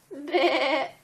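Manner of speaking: short, high bleating sheep. Acted. Reading to a toddler, a playful high sheep bleat. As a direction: bleat it like a lamb's mummy. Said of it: "Mommy Sheep says".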